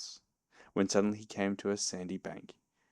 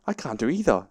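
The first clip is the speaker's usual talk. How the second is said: raised, louder complaint; mildly distressed complaint, pitched up; dismayed; 5 semitones higher, 5 dB louder than usual. A narrator's reading. Pooh stuck in the hole.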